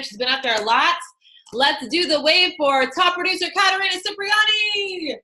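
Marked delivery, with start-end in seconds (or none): 1.5-5.2 high, excited announcer-style cheer; celebratory cheer. Real, not acted. Host cheering the team's top producer, celebratory cheer. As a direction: draw the name out in a rising-energy whoop.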